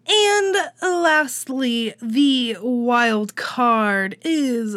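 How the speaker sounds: very slow, drawn-out announcement; suspense build. Acted. Suspense build before wild card.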